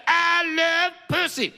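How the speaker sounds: high, slow, put-on purr; mock sultry voice. Comic teasing the crowd, high put-on voice. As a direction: tease the crowd with a silly voice.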